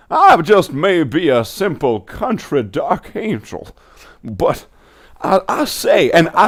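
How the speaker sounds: hammed-up Southern cartoon gent; cartoon Southern accent. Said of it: "southern impersonation of a cartoon".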